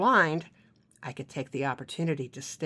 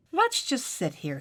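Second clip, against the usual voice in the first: intoxicating voice; 8 semitones higher than usual. Acted. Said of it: "he continued in that intoxicating voice".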